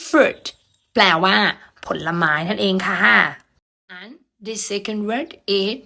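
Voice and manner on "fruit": "fruit" said alone, high falling pitch; model pronunciation. Shown as a demonstration. Teacher models "fruit" for students, model pronunciation. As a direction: pronounce "fruit" as a crisp word to repeat. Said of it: "Repeat after me again".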